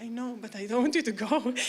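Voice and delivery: quick, laughing plea to stay; half-laughing, rushed protest. Retold or imitated. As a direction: plead fast, giggling through it. Retelling a laughing plea to guest.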